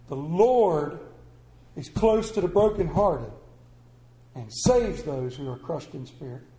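verse read again, LORD lifted and then the line sinking low; scripture repeated. Acted. Preacher repeating the verse so the room remembers it, emphatic. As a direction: press the verse home the second time.

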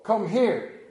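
firm call to a dog; plain command. Retold or imitated. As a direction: order the dog over. His own call to the dog.

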